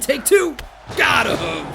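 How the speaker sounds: "take two" shouted high and loud; excited shout. Real, not acted. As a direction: belt it out like a hype call. Calling out a finisher move.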